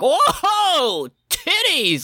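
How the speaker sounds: very high, drawn-out whoop; mock amazed outburst. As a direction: exclaim in hammed-up amazement, way up high. Acts out crude exclamation.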